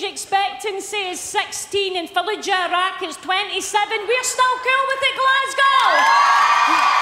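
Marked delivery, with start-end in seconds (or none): 4.1-7.0 high, loud hometown cheer; rallying crowd shout. Real, not acted. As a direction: build to a big shout on Glasgow.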